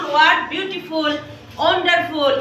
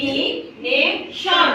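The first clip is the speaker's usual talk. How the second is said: slow syllable count; much slower than usual. Shown as a demonstration. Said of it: "কয়টা হয়েছে দেখো তো".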